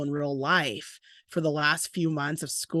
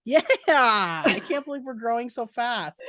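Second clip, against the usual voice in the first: sarcastic; 7 semitones higher than usual. Real, not acted. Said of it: "Are you being sarcastic?".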